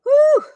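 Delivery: loud, high whew; flustered whew. Real, not acted. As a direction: blow out a big whew of overwhelm. Flustered working through the list.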